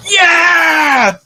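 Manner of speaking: loud, high victory roar; triumphant mock shouting. Football player spiking caught baby, roaring triumphant shouts. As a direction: yell it like a touchdown celebration. Joke hero celebrates catching baby.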